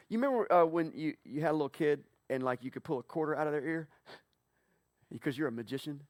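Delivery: normal talking voice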